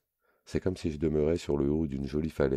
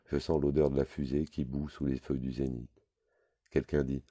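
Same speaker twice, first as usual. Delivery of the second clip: ordinary delivery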